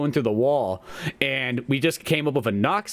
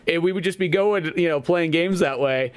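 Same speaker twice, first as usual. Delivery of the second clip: ordinary talk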